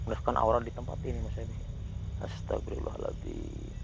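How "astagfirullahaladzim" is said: much lower, slow, breathy whisper; slow whispered prayer. Real, not acted. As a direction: draw the prayer out in a frightened whisper. Fearful prayer in a haunted spot.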